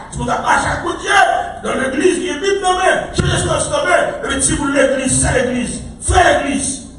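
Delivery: high, ringing preacher's cry; fervent preaching. Real, not acted. Preacher exhorting church members, fervent high cry.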